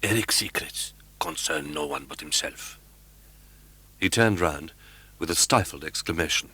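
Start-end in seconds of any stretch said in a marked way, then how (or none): 0.0-2.8 low, soft, close to the ear; a secretive murmur from behind; Persian's low warning in Raoul's ear. A narrator's reading. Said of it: "spoken in his ear".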